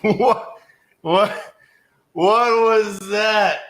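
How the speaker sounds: high, loud, drawn-out what; can't believe what he just heard; stunned disbelief. Real, not acted. After the rant ends, a stunned, high, loud what. Caller hangs up on him.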